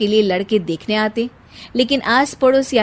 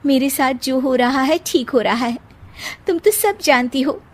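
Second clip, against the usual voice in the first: put-on sad young bride; 5 semitones higher than usual. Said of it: "Sheena कमरे में हाथ में गुड़िया लिए बोलती है".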